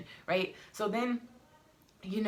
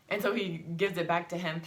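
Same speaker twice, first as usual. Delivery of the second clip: ordinary delivery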